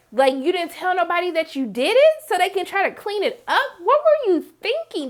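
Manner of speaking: high-pitched, outraged disbelief; incredulous scolding. Retold or imitated. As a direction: scold him like an appalled relative, voice climbing high. Acts her outraged reaction to Jacob.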